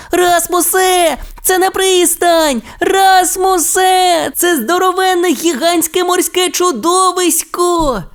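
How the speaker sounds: дуже високо, протяжно кличучи; кричи з жахом, попереджаючи; переляканий крик. Acted. (Тімі здалеку попереджає Расмуса: наляканий високий крик)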